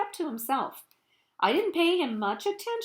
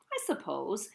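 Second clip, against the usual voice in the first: ordinary delivery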